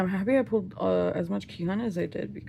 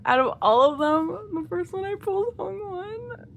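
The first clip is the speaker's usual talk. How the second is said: high, loud, thrilled squeal over a card; excited squeal; 11 semitones higher, 7 dB louder than usual. Real, not acted. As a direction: shriek with delight at the card.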